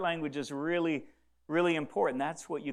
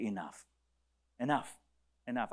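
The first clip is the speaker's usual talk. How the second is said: soft letting go; 7 semitones lower, 6 dB quieter than usual. Acted. Listener saying enough to grief.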